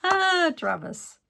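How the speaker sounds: high, loud, drawn-out cry of exasperation; exasperated. Real, not acted. Crafter spots her own backwards mistake, exasperated. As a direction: let out a real exasperated wail.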